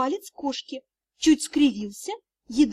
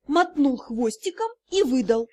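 ordinary delivery, nothing marked

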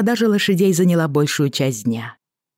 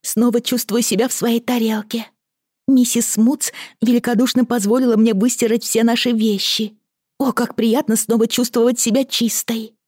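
cartoon princess; 9 semitones higher than usual. A narrator's reading. Maid Lizzie voiced in story.

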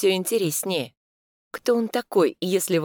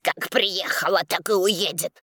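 angry growl; 3 semitones higher than usual. Acted. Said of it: "зло рычит председатель".

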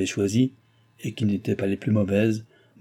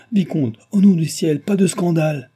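high, loud plea; crying out; 6 semitones higher, 6 dB louder than usual. A narrator's reading. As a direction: cry out to prevent a scene. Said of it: "cria la voix de Chon".

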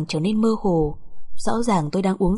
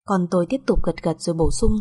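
plain reading voice

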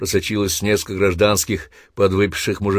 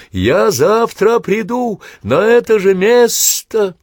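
very high, loud long-distance call; 14 semitones higher, 7 dB louder, much slower than usual. Acted. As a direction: shout through cupped hands. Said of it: "закричал он, сложив ладони рупором".

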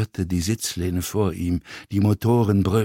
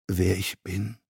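hoarse; 4 dB quieter than usual. A narrator's reading. Actor asks stewardess if she knows him, hoarse. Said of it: "sagte Wagenbach heiser".

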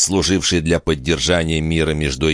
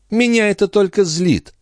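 high, irritated lady's voice; peevish countess. A narrator's reading. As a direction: complain crossly in a raised pitch. Countess says she is angered.

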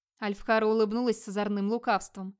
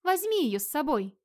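higher, distinct telepathic command; resonant, distinct; 6 semitones higher than usual. A narrator's reading. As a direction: clear instruction meant for one listener. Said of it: "звучный и отчётливый". (звучно и отчётливо)

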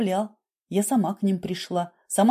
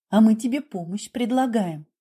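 plain reading voice

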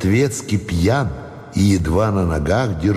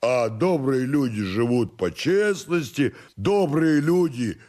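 soft, higher-pitched tipsy obstinacy; defiant drunken servant; 5 semitones higher, 5 dB quieter than usual. Acted. Drunken servant refuses master and moralises, soft stubborn voice. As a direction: refuse quietly and doggedly, moralising.

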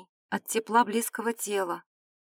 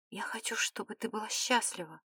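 unvoiced, much softer whisper; 9 dB quieter than usual. A narrator's reading. Philip wishes her happiness, in a true whisper. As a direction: whisper it under your breath. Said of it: "прошептал".

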